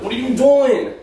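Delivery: high panicked pleading. Real, not acted. Panicking partner.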